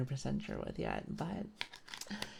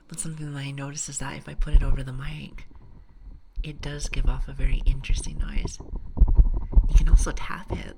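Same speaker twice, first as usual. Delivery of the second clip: ASMR whisper; 16 dB louder than usual. Real, not acted.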